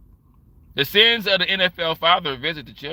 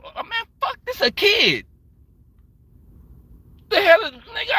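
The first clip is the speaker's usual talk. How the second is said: stunned high exclamation; retold shock at a kid's size. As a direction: gasp it out like you can't believe your eyes. Shocked at seeing the kid.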